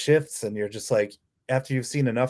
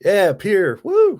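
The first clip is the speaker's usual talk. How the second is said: higher, louder whoop of delight; excited cheer; 10 semitones higher, 8 dB louder, much slower than usual. Real, not acted. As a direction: cheer your buddy on. Cheering a birth-year buddy.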